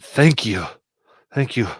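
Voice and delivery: grateful, pressured character voice; relieved rescue leader. Acted. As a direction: let the relief burst out between pauses. Game master acts grateful rescue leader.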